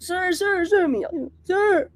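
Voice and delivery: hint of a flustered young woman calling sir; a mild anxious girl tugging at the officer; lightly acted frantic complainant. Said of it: "Drama, i-dramatize natin". (bahagyang kinakabahang dalagang tumatawag ng sir)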